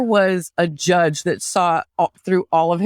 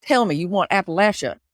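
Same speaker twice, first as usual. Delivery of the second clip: put-on Appalachian twang; Appalachian accent; faster than usual. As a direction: talk like an Appalachian local. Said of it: "this is more of an Appalachia".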